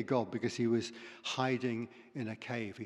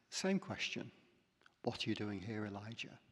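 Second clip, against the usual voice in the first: soft voice; 5 semitones lower, 10 dB quieter than usual. Retold or imitated. God asks again quietly.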